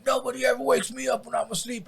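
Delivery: high put-on voice of the drunk neighbor; mock angry neighbor. Storyteller voicing the neighbor's threat with mock anger. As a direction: quote him like a belligerent drunk.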